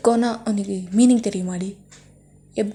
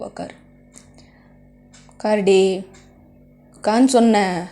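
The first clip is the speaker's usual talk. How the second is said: much louder command; barked order; 7 dB louder than usual. Acted. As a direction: order her down hard. Orders girlfriend to sit. (கடுமையான கட்டளை)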